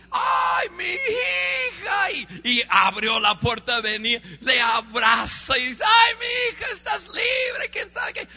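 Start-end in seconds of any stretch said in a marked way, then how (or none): 0.1-2.1 high, slow cry of relief; a mother's tearful joy; overjoyed mother. Retold or imitated. Mother sees daughter freed. 5.9-8.3 high crying exclamation of relief; tearful joy. Retold or imitated. Mother embraces freed daughter.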